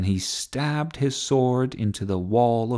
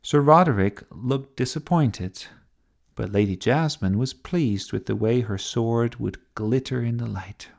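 ordinary delivery, nothing marked

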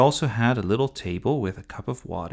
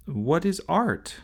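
little brother; 8 semitones higher, slower than usual. Acted. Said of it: "repeated Sophie".

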